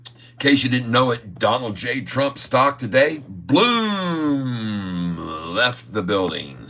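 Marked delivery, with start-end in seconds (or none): 3.3-5.2 long booming "bloom" falling away; drawn-out "bloom". Acted. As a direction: stretch "bloom" out like a launch. Imitates stock blasting off.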